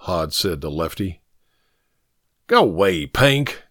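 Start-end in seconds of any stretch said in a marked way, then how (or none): 2.5-3.6 growled warning. A narrator's reading. Lefty sending Pink away, growling. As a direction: growl it as a brush-off. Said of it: "Lefty growled".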